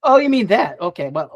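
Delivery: usual speaking voice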